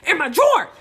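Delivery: loud, high, laughing retold yell; laughing shout. Retelling the call to Cece, laughing shout. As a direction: shout it at your friend through laughter. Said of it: "I'm like".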